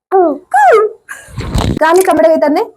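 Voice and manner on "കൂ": imitated koel cooing; cuckoo call. Shown as a demonstration. Showing a toddler how a koel calls, cooing. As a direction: coo out like a cuckoo. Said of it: "എങ്ങനെ കൂവുന്നേ".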